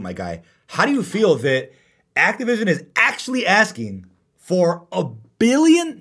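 ordinary talk

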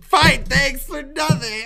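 high, loud, slow sarcastic whine; hammed-up wounded sulk; mock huffy retort. Friend mocking a sulking partner, high whiny voice. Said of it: "You're like".

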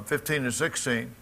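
ordinary delivery

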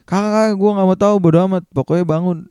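high, hurried refusal to listen; urgent insistence. Retold or imitated. Narrator to his sleepy friend who wants to wait, urgent insistence.

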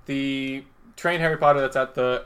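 ordinary talk, nothing marked

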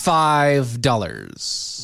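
FIVE stretched very long, then dollars; slow, lingering stress on Five; drawn-out emphasis. Real, not acted. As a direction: draw out "Five dollars" slowly. Drawn-out emphatic repeat of the price.

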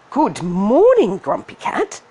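ladybug voice. Acted. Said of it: "the ladybug said".

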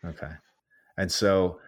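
normal talking voice

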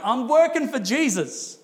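high, drawn-out self-important boast; mock zeal. Gap-filling believer announcing their busy work, mock zeal. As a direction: stretch it out in mock earnestness.

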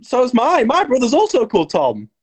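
high, loud mock revelation; mock astonishment. Scottish dude discovers a coincidence, mock astonishment. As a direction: exclaim it high, hammed up. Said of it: "the Scottish dude goes".

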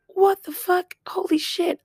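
squealed re-enactment of shock; mock screaming. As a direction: act out a panicked scream at contained volume. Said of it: "I'm, like, screaming".

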